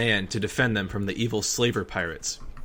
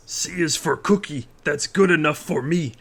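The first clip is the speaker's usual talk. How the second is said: higher, slow, sung-out monster voice; Cookie Monster voice; 4 semitones higher than usual. A narrator's reading. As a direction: sing it like a goofy puppet monster. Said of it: "I sang in my best Cookie Monster voice".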